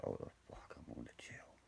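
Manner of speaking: hushed whisper; panicked whisper. Retold or imitated. Panicking as cops arrive.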